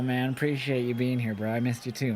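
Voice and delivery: ordinary delivery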